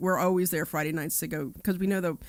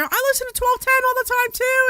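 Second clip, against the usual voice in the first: much higher, louder fan voice; eager fan; 7 dB louder than usual. Retold or imitated. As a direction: blurt it out like a devoted listener. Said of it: "somebody else is like".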